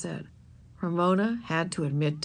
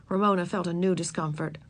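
ordinary delivery

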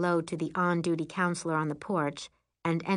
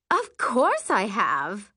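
slow, superior drawl; haughty; 4 semitones higher, 6 dB louder, much slower than usual. Acted. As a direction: look down your nose at them. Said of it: "with extra haughtiness".

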